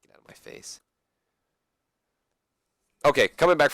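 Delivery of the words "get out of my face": hushed whisper; whispered brush-off. Real, not acted. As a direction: breathe it out with no voice. Muttered aside.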